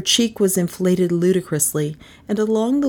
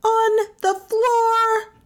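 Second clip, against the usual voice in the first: slow, very high, drawn-out command; shouted order; much slower than usual. A narrator's reading. Melissa banishing Carstairs to the floor, shouting. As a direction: stretch each word high like a furious order. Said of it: "Melissa shouted".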